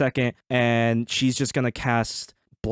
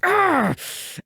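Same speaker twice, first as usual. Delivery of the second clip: high, strained cry of pain; acted wounded scream; pained yell. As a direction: yell as if just run through. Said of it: "Sir Arnold's like".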